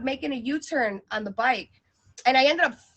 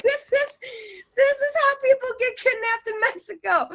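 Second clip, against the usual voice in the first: mock panic; 9 semitones higher than usual. Panicked retold thought.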